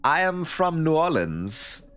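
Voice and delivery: quoting Tommy Wiseau in a lightly put-on accent; Tommy Wiseau claiming New Orleans, slow mock accent; imitating Tommy Wiseau. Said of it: "And he'll go".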